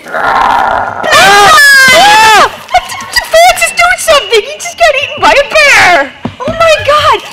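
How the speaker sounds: high, loud terrified screams; panicked screaming. Acted. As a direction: scream for help as the bear attacks. Bear eats child, panic.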